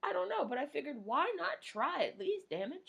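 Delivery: ordinary delivery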